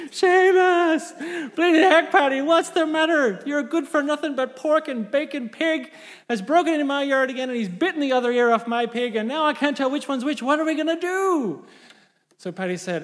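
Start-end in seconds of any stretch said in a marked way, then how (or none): 0.1-11.7 mock yelling. Paddy rages about the bitten pig, hammed-up high yelling. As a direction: yell the name, then wail the complaint. Final acted shouting and complaint.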